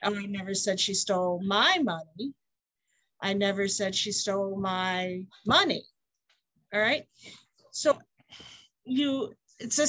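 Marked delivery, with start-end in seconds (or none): 0.1-2.3 all the weight on "my"; stress on MY. Shown as a demonstration. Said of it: "enunciate every word differently". 3.2-5.8 all the weight on "money"; stress on MONEY. Shown as a demonstration. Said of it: "enunciate every word differently".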